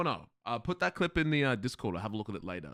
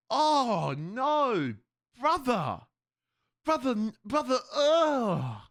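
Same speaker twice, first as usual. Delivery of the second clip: high, loud mock disgust; hammed-up high-pitched revulsion; meme impression; 11 semitones higher, 4 dB louder, much slower than usual. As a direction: play up the appalled "brother, ugh" meme. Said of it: "bring back that meme".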